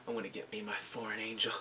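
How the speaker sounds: whispered scheming aside; sly whisper. Retold or imitated. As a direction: whisper it like a secret plan. Friend's scheming quote.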